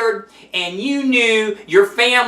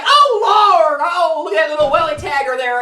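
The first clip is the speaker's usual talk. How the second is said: mock shocked gasp; 6 semitones higher than usual. Retold shock at naked man.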